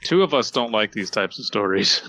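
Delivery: ordinary talk, nothing marked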